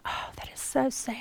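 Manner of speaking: soft, whispered sorrow; sad whisper. Retold or imitated. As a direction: whisper it, moved by the story. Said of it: "and you just go".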